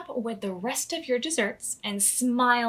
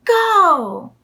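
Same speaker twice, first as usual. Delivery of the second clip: loud, high starting shout; 9 semitones higher, 15 dB louder than usual. A narrator's reading. Chef starting the baking race, loud cheer. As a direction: yell it like a starter's call.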